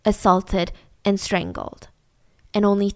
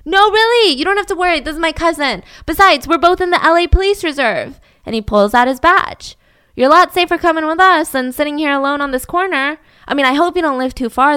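high loud reassurance; Kenny flashing a badge to reassure Jane; mock reassuring cop; 4 semitones higher, 6 dB louder than usual. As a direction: make her feel safe.

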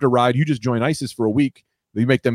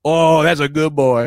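slow, higher cooing praise; mock praise; 4 semitones higher, slower than usual. Host praising a caller's good deed, slow mock cooing. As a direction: coo it like praising a dog.

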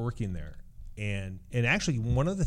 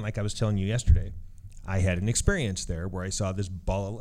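ordinary delivery

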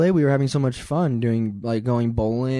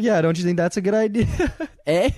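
higher, quicker, teasing Canadian eh; mock Canadian eh; 7 semitones higher than usual. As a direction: mimic her upbeat Canadian eh. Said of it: "she's like".

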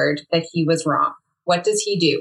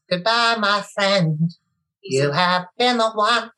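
[name] impression; 3 semitones higher, slower than usual. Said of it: "impression of [name]".

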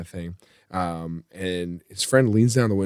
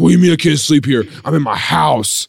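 put-on indignant drunk; 5 semitones higher, 4 dB louder than usual. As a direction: insist indignantly, imitating the drunk. Drunk man argues he's home.

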